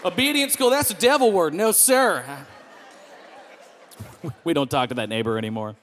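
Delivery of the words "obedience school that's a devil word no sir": hammed-up, higher Southern voice; indignant put-on Southern twang; exaggerated Southern accent. Rejecting obedience school to the neighbor in a Southern accent. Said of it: "In the most southern accent".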